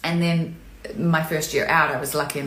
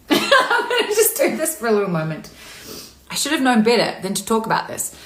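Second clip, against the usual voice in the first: tearful laugh, voice pushed higher; choked up; 4 semitones higher than usual. Real, not acted. Streamer who thought she could get through it, choked up. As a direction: laugh through the tears as they take over. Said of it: "I thought I could get through it".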